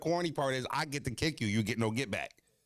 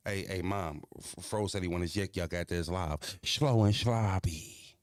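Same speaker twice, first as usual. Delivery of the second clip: dragged-out ending; 4 semitones lower, slower than usual. Shown as a demonstration. Streamer showing how to deliver the message, ending dragged out slow. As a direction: let the final phrase crawl out. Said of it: "with the accent".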